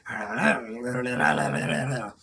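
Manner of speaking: low, strange creature croak; weird creature noise. Shown as a demonstration. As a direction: make the weird creature sound. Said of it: "All I can do is just go".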